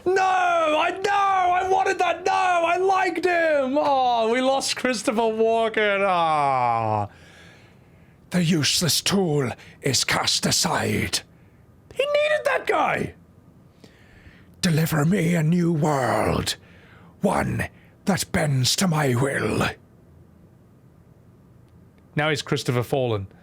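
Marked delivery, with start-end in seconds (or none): 0.1-7.1 high, drawn-out wailing that sinks at the end; slow, overdone lament, sliding down; mock grief. Mock grief over losing the character. 8.3-11.2 put-on menacing villain voice. Villain's lines. 11.9-13.1 very high, drawn-out protest; mock wail. 14.6-19.7 slow, put-on villain voice. Villain's lines.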